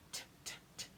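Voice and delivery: "T" as voiceless tuh; t sound. Shown as a demonstration. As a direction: tap each "T" lightly. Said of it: "Then T is up here".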